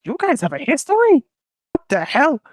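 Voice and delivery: high-pitched mock disbelief; hammed-up comic astonishment; shocked question. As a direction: ask with the pitch jumping high. Korea shocked at their history.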